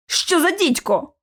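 high-pitched, drawn-out alarmed oath; startled cursing. A narrator's reading. Lockwood swearing as George lurches aside, startled. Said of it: "вилаявся Локвуд".

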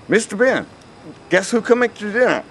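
high, sneering servant's jab; sarcastic. A narrator's reading. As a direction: drip sarcasm as you announce the guest. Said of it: "Hop Sing says sarcastically".

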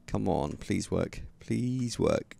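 soft slow hopeful plea; quiet pleading. Real, not acted. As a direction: beg it under your breath. Pleading with game.

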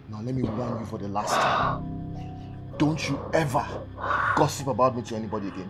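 low, quiet threat; menacing warning. Acted. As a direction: warn with the voice dropped low. Husband gives final warning.

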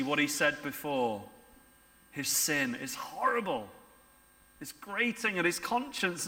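ordinary delivery

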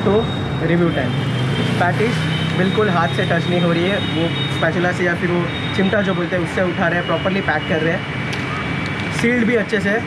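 muffled behind respirator; speaking into mask fabric; through a face mask. Real, not acted.

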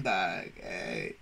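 ordinary talk

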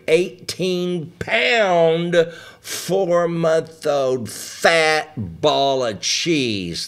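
hammed-up baby-cheese praise; mock grand description. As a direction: savor each word like a treat.

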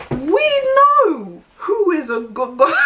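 high, loud laughing outburst; laughing shout. Real, not acted. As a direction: burst out laughing as you say it. Laughing outburst about men.

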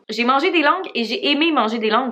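ordinary talk

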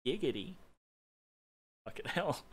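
ordinary delivery

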